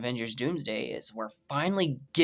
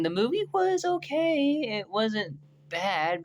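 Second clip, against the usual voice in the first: slow, much higher grudging drawl; 9 semitones higher, slower than usual. Real, not acted. Reviewer grudgingly rating Captain America film, reluctant drawl. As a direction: say it like you're forcing a compliment.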